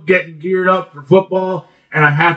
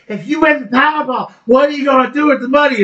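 reporter voice; 10 semitones higher than usual. Retold or imitated. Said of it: "she's like".

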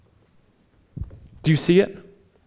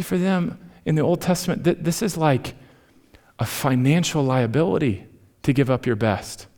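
usual speaking voice

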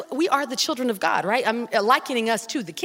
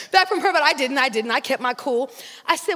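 ordinary delivery

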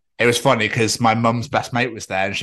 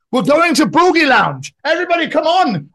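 shouting across streets; 8 dB louder than usual. Retold or imitated. Man rallying strangers to a club, shouting. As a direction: holler it high at people across the street. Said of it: "like, shouting at people across the streets".

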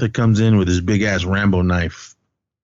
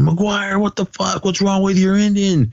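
high-pitched retold outrage, no real volume; mock scream; 11 semitones higher than usual. Retelling Peter screaming after the truck rams a car, mock scream. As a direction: imitate an outraged yell by pitch alone. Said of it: "Peter starts screaming".

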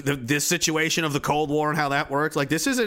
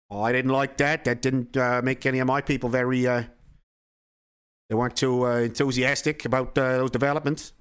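lightly voiced Russian boss, grumbling and hesitant; Soviet leader; 5 semitones lower than usual. Soviet leader grumbling to Kennedy about Cuba, lower and hesitant.

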